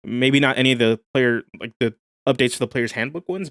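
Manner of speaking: ordinary talk, nothing marked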